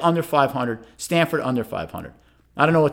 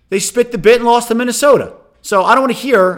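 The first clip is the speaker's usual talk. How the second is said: higher, louder scornful jab; exasperated rant; 8 semitones higher, 6 dB louder than usual. Real, not acted. Host mocking Wisconsin's collapse, scornful and raised. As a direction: spit out the scorn. Venting about Wisconsin's loss.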